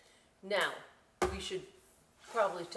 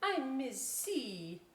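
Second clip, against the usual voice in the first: slow, lightly voiced teacher; lightly voiced gentle teacher; smiling teacher; 5 semitones higher, much slower than usual. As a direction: greet him kindly and slowly. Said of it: "the teacher smiled at him".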